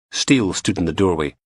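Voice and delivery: usual speaking voice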